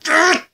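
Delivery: high, sudden "ugh"; short exclaimed grunt; acted grunt of shock. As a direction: let out a sharp high-pitched grunt at the kill. Acts shock at the chest stab.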